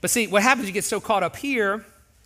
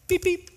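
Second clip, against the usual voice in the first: horn imitation; 11 semitones higher than usual. Shown as a demonstration. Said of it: "the first one is this".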